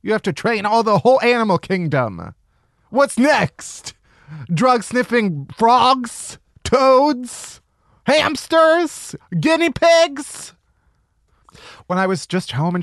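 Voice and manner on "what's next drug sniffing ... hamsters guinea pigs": rising incredulity. Real, not acted. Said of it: "escalating list".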